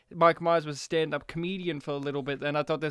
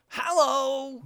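high, loud mock catchphrase; comedian's catchphrase; 8 semitones higher, 5 dB louder than usual. Podcaster imitating a film character's catchphrase, high and loud. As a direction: call it out like a comic's signature line. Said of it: "he does have a catchphrase".

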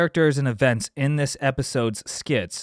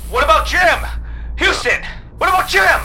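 climbing higher in panic; rising panic; 12 semitones higher, 6 dB louder than usual. Acted. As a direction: get more desperate each time.

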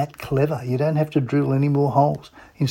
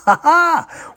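delighted laugh. Real, not acted. Host laughing at own joke.

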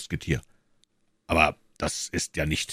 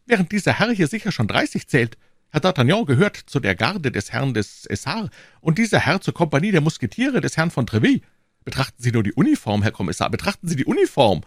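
high-pitched, fast, insistent character voice; agitated pleading prisoner; 9 semitones higher, 3 dB louder than usual. Acted. Prisoner pleading his case to the commissioner, high and agitated. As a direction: argue your case in a high, excitable voice.

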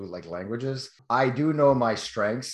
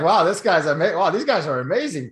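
louder, higher mock-impressed gushing; imitated amazement; 4 semitones higher, 6 dB louder than usual. Onlookers begging for more fake German, mock amazed. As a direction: gush like a fooled fan, hammed-up.

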